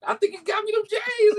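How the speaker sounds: high squealing excitement, almost crying out; shrieking high with delight; overjoyed. Retold or imitated. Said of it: "I'm like".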